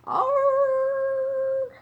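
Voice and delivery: long, high, loud held "arr"; imitated loud roar. A narrator's reading. Detective imitates the sound they heard, loud roar. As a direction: mimic the beast's call at full volume. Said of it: "Elephants do not sound like that".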